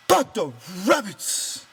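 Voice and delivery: high and loud, almost a shout; near shout. A narrator's reading. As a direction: blurt it out eagerly. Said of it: "Lenny almost shouted".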